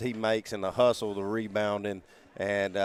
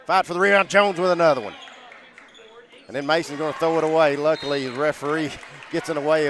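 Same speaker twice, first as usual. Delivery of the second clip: excited play-by-play; 4 semitones higher, 5 dB louder, faster than usual. Real, not acted. Live play-by-play scramble.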